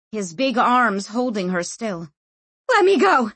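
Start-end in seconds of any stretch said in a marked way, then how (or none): 2.7-3.3 loud, high desperate scream. A narrator's reading. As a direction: scream to be released. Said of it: "she screamed".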